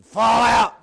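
loud barked command; drill sergeant shout. Retold or imitated. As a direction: bark the order.

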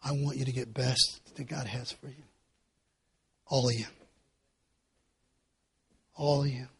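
very soft, low, gentle; tender blessing. Acted. Preacher blessing all the young people, soft and gentle. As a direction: bless them quietly.

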